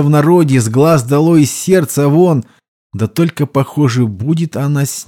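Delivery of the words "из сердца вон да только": ordinary delivery, nothing marked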